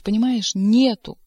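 loud frantic yell; desperate shout. A narrator's reading. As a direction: shout the denial. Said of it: "страшным голосом прокричал". (отчаянный крик)

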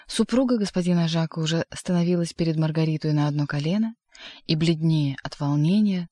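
ordinary delivery, nothing marked

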